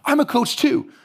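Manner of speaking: high, eager reveal; bursting to tell her; excited. Retold or imitated. Telling a coach at the hotel he coaches too, high and eager.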